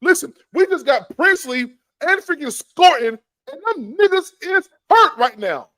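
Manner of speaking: loud, high protest; heated outburst. Real, not acted. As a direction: raise it up in frustration. Heated argument with Nick.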